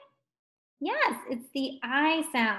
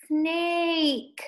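SNAKE with a long raised A; stretched vowel; 6 semitones higher than usual. Shown as a demonstration. Teacher introducing the picture, SNAKE stretched out. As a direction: draw out the vowel in SNAKE, pitched up.

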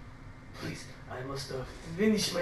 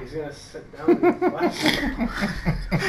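words broken by laughter; laughing while talking; 5 semitones higher, 6 dB louder, much slower than usual. Real, not acted. Player laughing at the failed save. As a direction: giggle through it slowly.

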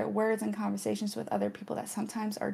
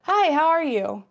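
cheery greeting; 6 semitones higher, 6 dB louder than usual. Retold or imitated. Mock greeting from acquaintance.

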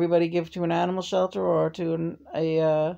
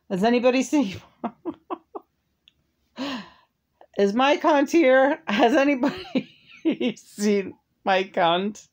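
laughing call-out; 8 semitones higher than usual. Real, not acted. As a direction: shout it out while cracking up.

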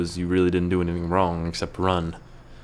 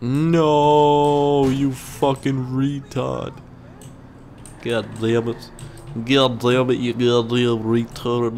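slow, higher mock-tantrum voice; imitating a kid's voice; 5 semitones higher, 3 dB louder, much slower than usual. Gamer cursing at a driver, imitated kid voice. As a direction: put on an odd kid voice, stretched out and scolding. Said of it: "I heard a kid talking like that".

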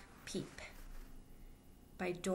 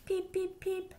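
high squeaky little duckling peeps; baby duck peeping; 11 semitones higher, slower than usual. Acted. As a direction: chirp it out high like a tiny duck. Baby duck's peeps in story.

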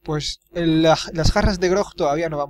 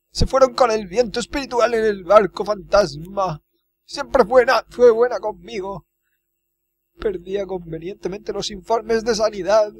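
higher-pitched, hammed-up wailing; weeping cook; 6 semitones higher, 4 dB louder than usual. Cook telling of the governor's kidnapping, in mock weeping. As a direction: play a mock-tearful lament over the loss. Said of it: "Está llorando, pobre cocinero".

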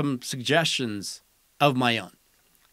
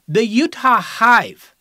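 higher, louder and slow, a grand reveal; slow name announcement; 6 semitones higher, 6 dB louder, slower than usual. Acted. Host unveiling a proposed team name, loud and slow. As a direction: announce it big and drawn out. Reading out candidate team names.

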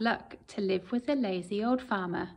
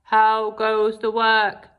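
lazy farmer calling; 9 dB louder, slower than usual. Acted. Lazy farmer calling from bed to the duck. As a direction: call down lazily from bed. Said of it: "called the farmer".

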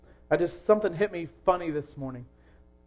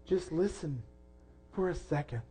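much softer, gentle "Just listen for a second"; softly; 9 dB quieter than usual. Shown as a demonstration. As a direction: ease it down almost to a hush. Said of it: "that's what God's calling to us today".

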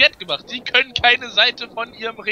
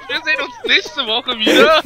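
wrestling commentator; 6 semitones higher than usual. Pretend. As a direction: wrap up the show like an announcer. Signs off as TV commentator.

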